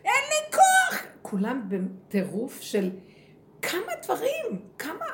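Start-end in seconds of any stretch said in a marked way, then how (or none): 0.1-1.0 much higher, loud exhausted cry; mock cry of someone who can't go on; imitated shout. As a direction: yell it out like a worn-out generation. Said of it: "וצועק כמו הדור שלנו".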